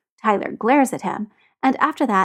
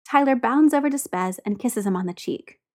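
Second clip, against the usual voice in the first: ordinary delivery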